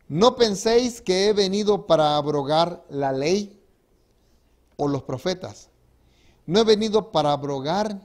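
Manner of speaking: preacher booming out the words of Jesus; forceful scripture reading. Acted. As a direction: proclaim it to the congregation.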